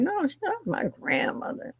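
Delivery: squeaky-high retold denial. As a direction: mimic a protesting reply up high. Retelling an indignant denial.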